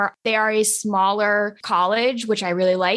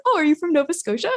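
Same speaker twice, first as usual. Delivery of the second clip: higher, quicker cheery mock question; imitated happy question; 8 semitones higher, faster than usual. College classmates asking about her hometown, imitated happy question. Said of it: "They said it all happy like that".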